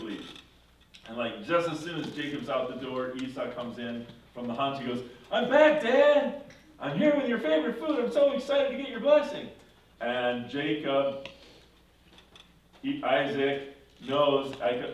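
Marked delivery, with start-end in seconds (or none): 5.3-9.5 loud, high, eager announcement; excited son. Retold or imitated. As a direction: burst in as the delighted son, acted big. Esau returns eager for blessing.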